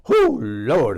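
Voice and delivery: loud, swooning recollection with drawn-out exclamations; flustered, punchy bursts between pauses; worked up. Real, not acted. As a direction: get carried away by a steamy memory. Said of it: "worked up here thinking about it".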